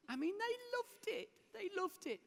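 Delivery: very high, gleeful squeal; delighted. Real, not acted. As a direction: pitch it way up with delight. Delight at pupils' reaction.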